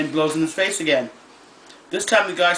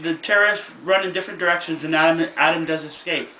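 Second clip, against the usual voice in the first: usual speaking voice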